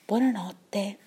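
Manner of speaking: hushed bedtime goodbye; soft goodnight. Real, not acted. Goodnight sign-off to children.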